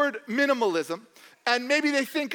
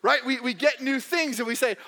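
usual speaking voice

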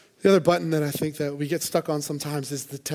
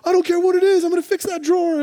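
much higher and louder, hammed-up fix-it husband; mock husband; 10 dB louder than usual. Pastor mimicking a husband whose wife cries, loud mock eagerness. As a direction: play the husband who must fix everything. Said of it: "He's like".

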